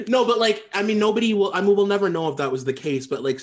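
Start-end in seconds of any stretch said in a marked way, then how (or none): none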